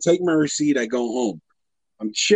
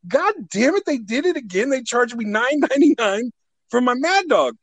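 higher-pitched, exasperated outburst; retold exasperation; 7 semitones higher than usual. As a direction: relive the outrage of being overcharged. Reliving finding another overcharge.

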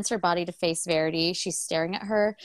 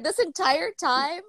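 stunned disbelief; 7 semitones higher, slower than usual. Retold or imitated. Husband realizes long deception.

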